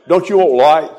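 ordinary talk

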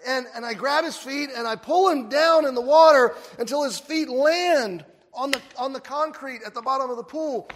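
ordinary delivery

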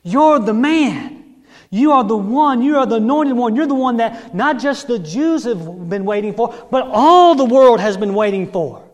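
preacher voicing fervent acclaim; exultant acclamation. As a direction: hail him loudly as the promised one. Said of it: "this Greek woman is saying".

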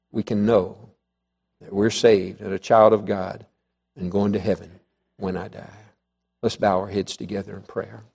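low, soft pastoral voice; gentle altar call. Real, not acted. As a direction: ease them quietly toward prayer. Leads congregation into prayer.

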